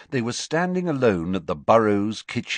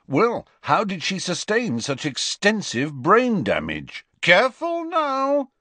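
taunting; 13 semitones higher, 4 dB louder than usual. A narrator's reading. Fred goading Ron about his girlfriend, taunting. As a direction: needle him, then call out high and teasing.

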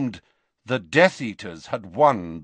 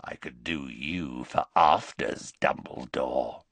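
menacing werewolf; 8 semitones lower, 4 dB quieter than usual. Acted. Greyback to Dumbledore, a low leering threat. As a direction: lower and softer, leering threat.

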